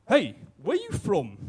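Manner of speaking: burly Afrikaner, slow, high and loud; Afrikaner accent. Acted. Burly bar patron challenges the strangers, Afrikaner accent. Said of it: "burly Afrikaner chap".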